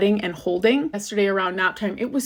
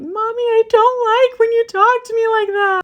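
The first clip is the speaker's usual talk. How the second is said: put-on very high, louder, tearful child voice; crying little girl; 6 dB louder than usual. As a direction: play the hurt little daughter crying to mommy. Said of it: "she started crying and saying".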